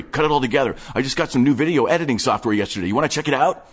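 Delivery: breathless nerdy enthusiast showing off his stash; manic collector Wade. Acted. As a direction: rattle it off like an excited hoarder. Narrator voices Wade, manic collector.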